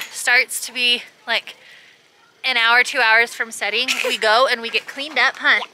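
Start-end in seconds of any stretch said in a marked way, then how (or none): none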